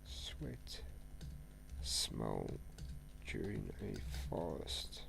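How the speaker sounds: slow soft words to himself; slow quiet typing read; soft reading aloud. Real, not acted. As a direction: murmur the question as you type. Reads a search aloud.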